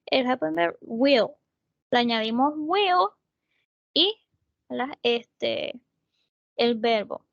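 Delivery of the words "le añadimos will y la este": ordinary delivery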